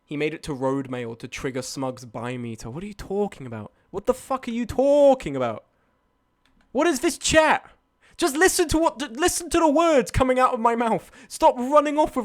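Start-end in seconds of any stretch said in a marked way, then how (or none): 3.9-12.2 high-pitched, incredulous rant; exasperated scolding. Real, not acted. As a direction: tell the chat off, pitch climbing. Scolds chat for twisting the story.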